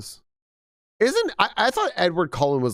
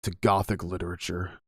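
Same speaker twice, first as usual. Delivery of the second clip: lower, quieter deep-voiced lecturer; deep voice impression; 5 semitones lower, 3 dB quieter than usual. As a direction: imitate a towering serious teacher booming welcome. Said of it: "he does, like, really deep voice".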